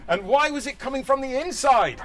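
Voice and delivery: ordinary talk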